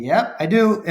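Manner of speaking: high, loud, laughing yes; laughing high reply. Retold or imitated. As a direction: laugh through the answer. Answers son, laughing.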